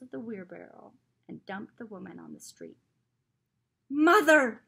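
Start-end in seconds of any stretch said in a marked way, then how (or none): 3.9-4.6 loud, high anguished scream. A narrator's reading. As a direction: scream it in terror. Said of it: "I screamed".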